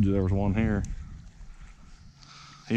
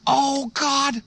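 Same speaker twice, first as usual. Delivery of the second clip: excited; 7 dB louder, much slower than usual. Real, not acted. Fish suddenly hooked.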